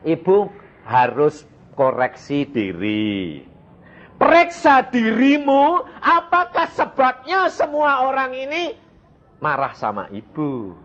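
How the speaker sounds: slow, deliberate counsel that climbs to a high insistent pitch; retold admonishing. Preacher retelling his advice to the complaining woman, slow admonishing. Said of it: "jadi saya berkata".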